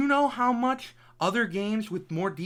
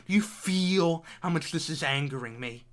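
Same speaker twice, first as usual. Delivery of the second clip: usual speaking voice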